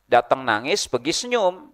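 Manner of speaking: ordinary talk, nothing marked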